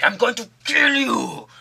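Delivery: high, strained put-on rage; mock furious threat. Headbutted defender threatening his attacker, high mock rage. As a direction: threaten wildly, hammed up.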